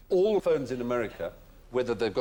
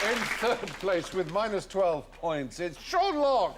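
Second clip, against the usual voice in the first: game show host; 9 semitones higher, 3 dB louder than usual. Acted. Announces the final scores.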